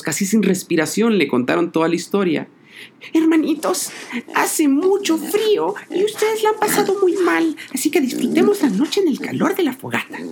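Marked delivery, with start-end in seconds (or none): 3.1-10.2 high, caring piglet voice; big sister pig. A narrator's reading. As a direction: gently welcome her brothers. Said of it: "dijo la cerdita mayor".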